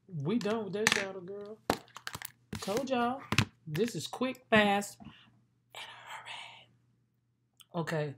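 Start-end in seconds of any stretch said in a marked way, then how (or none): 5.0-7.8 whisper. Real, not acted. Rushed makeup aside to viewers.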